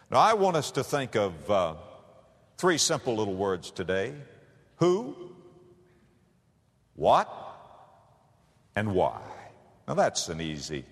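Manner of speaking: ordinary delivery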